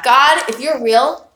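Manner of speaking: crying out to God. Retold or imitated. Her doubting younger self crying out to God, a slow loud plea. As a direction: plead with God, drawing the words out. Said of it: "where I cried out to God".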